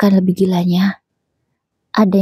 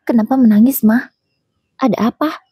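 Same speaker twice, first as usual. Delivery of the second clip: higher, lightly voiced young boy, worried; a small son asking his mother why she cries; child's voice; 4 semitones higher than usual. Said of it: "tanya Adam lagi".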